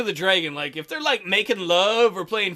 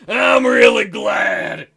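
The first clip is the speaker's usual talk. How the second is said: loud burst sinking to a slow, low tone; choked with emotion; 3 semitones lower, 5 dB louder, slower than usual. A narrator's reading. Zell confessing his gratitude to a partner, choked up. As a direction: speak slowly, as if overcome with feeling. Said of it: "his voice sounds choked with emotion".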